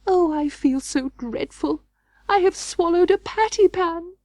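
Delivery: high, whining moan; moaning whine. Acted. Duchess moaning to Ribby, high and whining. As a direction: whine it out in misery. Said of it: "Duchess moaned and whined".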